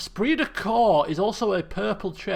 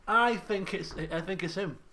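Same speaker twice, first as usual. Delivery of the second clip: normal talking voice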